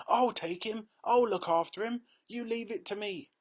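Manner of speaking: high, loud eager promise; eager old man. A narrator's reading. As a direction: insist on going, thrilled. Grandpa Joe eager.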